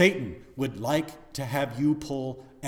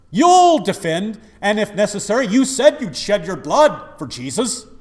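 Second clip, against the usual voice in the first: preaching at full force; 9 semitones higher, 14 dB louder than usual. Acted. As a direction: preach it like a revival climax. Preaching the arrest scene.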